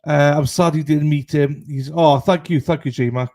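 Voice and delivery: normal talking voice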